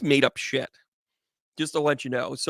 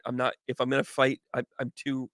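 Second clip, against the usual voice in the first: ordinary delivery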